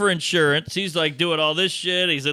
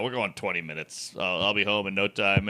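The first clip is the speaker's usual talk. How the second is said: much lower, much softer mumble; sheepish mumble; 10 semitones lower, 7 dB quieter than usual. Retold or imitated. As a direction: play it down, barely audible. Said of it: "And I go".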